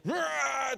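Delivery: high, short mock roar; a quick hammed-up growl of rage; mock angry roar. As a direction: roar like a little angry guy. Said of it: "his whole demeanor changed from".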